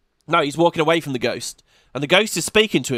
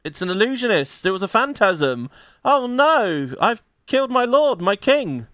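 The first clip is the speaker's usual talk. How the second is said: mock horrified realization; 6 semitones higher than usual. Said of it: "he realizes".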